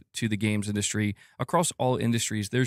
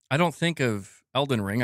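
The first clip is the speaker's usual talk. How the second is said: normal talking voice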